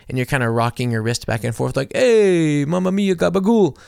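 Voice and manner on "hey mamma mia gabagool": mock Italian. Friends giving the silent cue in mock Italian. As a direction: throw in a hammed-up Italian accent. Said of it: "classic stereotypical Italian hand gesture".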